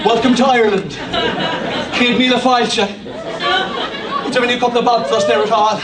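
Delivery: a lightly put-on Irish accent; jokey Irish host, laughing; mock Irish lilt. Preacher joking about Irish hospitality, light Irish accent.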